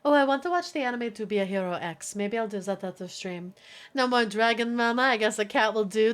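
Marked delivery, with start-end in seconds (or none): none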